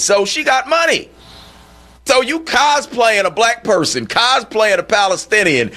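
host exasperated with a teacher faking her identity; indignant disbelief. Real, not acted. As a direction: pitch up, call out the hypocrisy. Outraged rant about cosplaying oppression.

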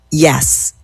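ordinary delivery

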